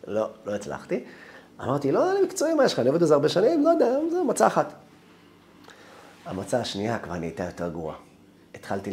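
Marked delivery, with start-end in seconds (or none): 1.9-4.7 high-pitched, defensive excuse; flustered protest. Retold or imitated. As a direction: protest your competence in a rising squeak. Flustered self-defence to the boss. (התגוננות נבוכה בקול גבוה)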